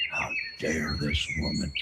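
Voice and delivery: hushed, low, scandalized gasp of offense; mock outrage. Streamer feigning offense that Debbie Fox insulted Mel Gibson. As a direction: clutch your pearls quietly.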